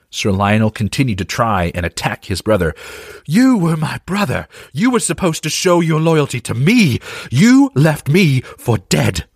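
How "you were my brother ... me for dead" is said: raised, furious voice with rising pitch; angry shout. Acted. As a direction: shout it with each sword swing. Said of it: "Lionel shouted at Bors".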